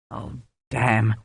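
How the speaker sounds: soft, deflated sigh of a curse; sad. A narrator's reading. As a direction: mourn the lost wine quietly. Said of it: "Runciman said sadly".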